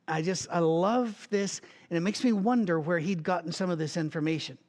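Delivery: usual speaking voice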